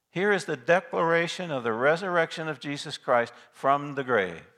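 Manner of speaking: normal talking voice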